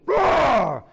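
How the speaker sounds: a big mock roar, loud and high; playful roar. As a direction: roar like a playful dad at his baby. Father roaring at baby in game.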